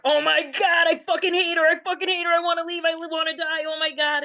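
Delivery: reliving the panic, half-shouting that she hates her; retold outburst. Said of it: "And I'm like".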